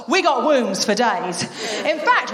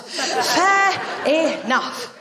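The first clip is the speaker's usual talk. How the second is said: laughing; 2 semitones higher, much slower than usual. Real, not acted. Laughing concession.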